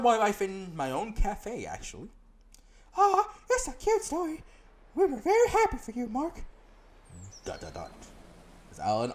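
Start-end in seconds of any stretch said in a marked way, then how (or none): 3.0-6.4 put-on bright girl guide voice, much higher; high companion voice. Companion greeting the player in town, high voice. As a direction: play the chirpy companion up high.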